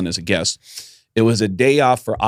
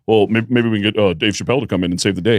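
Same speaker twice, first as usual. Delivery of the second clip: imitating Bert; faster than usual. Bert Kreischer retold.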